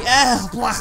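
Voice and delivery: disgusted "ugh, blah" noise; mock retching at something gross. Joking that spiders are ugly, mock disgust.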